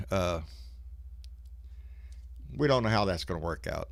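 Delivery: ordinary talk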